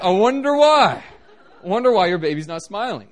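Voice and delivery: high, loud, laughing mock innocence; sarcastic laughing wonder. Preacher teasing the stone-faced relatives, loud laughing sarcasm. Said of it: "I'm like".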